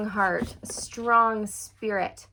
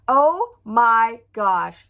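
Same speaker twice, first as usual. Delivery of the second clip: loud, slow amazed exclaim; awed oh my gosh; 9 dB louder, much slower than usual. Real, not acted. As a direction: let it stretch out in awe. Awed by the cards.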